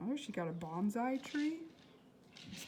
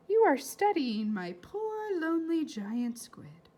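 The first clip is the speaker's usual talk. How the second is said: put-on gentle old doctor; 8 semitones higher, 8 dB louder than usual. Old doctor greets children.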